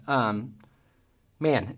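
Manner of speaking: ordinary delivery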